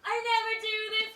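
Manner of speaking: ordinary talk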